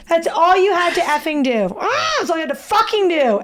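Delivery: high-pitched, worked-up exasperation; frustrated outburst, pitch soaring; exasperated. Real, not acted. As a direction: burst out in comic outrage. Exasperated rant at friend's failure.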